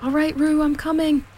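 high-pitched reassuring call. A narrator's reading. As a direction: call ahead that help is on the way. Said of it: "were calling out to the others".

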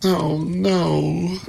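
high-pitched, mildly tearful refusal; starting to sob. A narrator's reading. Pandora refusing the voice in the box, mildly sobbing. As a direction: refuse as if tears are coming. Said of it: "again beginning to sob".

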